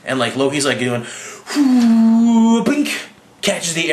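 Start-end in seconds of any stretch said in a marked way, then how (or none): 1.6-2.6 mock whoosh sound. Loki dodging the arrow.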